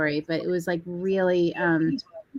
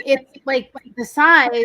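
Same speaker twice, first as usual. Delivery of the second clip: higher and louder, pleading that the bag is tiny; exasperated protest; 6 semitones higher, 7 dB louder than usual. Retold or imitated. To the venue staff refusing her bag, exasperated protest. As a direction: push back in disbelief at the guard.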